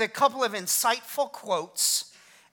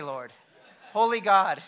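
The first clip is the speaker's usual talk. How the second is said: ordinary talk, nothing marked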